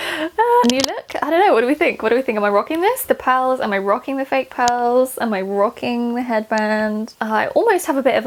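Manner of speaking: ordinary talk, nothing marked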